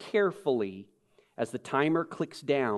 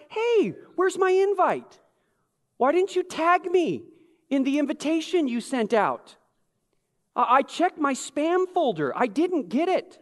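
much higher, aggrieved whine in jest; mock petty complaint; 11 semitones higher, 4 dB louder than usual. Guest left off the invitation protesting, mock aggrieved. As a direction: play the snubbed guest, pitched way up.

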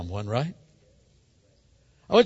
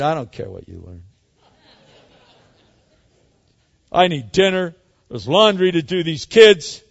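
irritated brush-off; 7 dB louder than usual. Acted. Wife rebuffs excited husband.

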